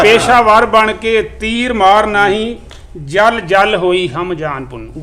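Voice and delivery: measured, even recital of verse; poem recitation. Acted. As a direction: recite each line like a mushaira couplet. Recites Punjabi poem to audience.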